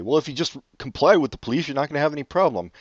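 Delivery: quick, dismissive imitation of a know-it-all uncle. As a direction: rattle it off like a smug relative lecturing. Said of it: "racist uncles say".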